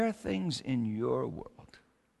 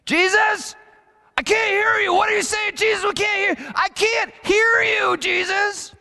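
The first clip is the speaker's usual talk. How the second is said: put-on high, loud yelling toddler; child yelling; 10 dB louder than usual. Three-year-old son yelling at Jesus to speak up, high loud shout. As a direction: shout it like a small boy with fingers in his ears. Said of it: "just yelling".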